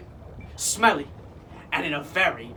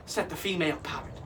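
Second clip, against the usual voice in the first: plain reading voice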